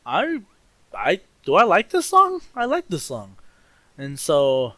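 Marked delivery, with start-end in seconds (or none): 0.0-3.4 surprised realization. Retold or imitated. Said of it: "I was like".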